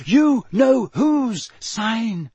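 high, loud, drawn-out dread; frightened moan. A narrator's reading. Hermione pulls Harry as hard as she can, frightened moan. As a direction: stretch each word in alarm. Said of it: "Hermione moaned, pulling him".

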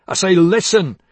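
furious order; angry command. Acted. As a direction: bark it to make her listen. Angry demand in quarrel.

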